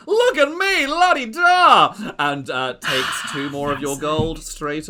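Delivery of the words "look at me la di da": high, loud gushing diva; gloating aunt voice. Acted. Lady Ziza taunting the player at the dice table, high gushing diva. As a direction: coo the taunt sweetly, pitched way up.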